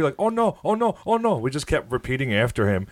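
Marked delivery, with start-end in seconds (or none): 0.2-1.4 high, sophomoric parroting; a high, childish echo of the rival fan; childish mockery. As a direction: mimic him like a schoolkid. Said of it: "making fun of him in the most like sophomoric, like childish way".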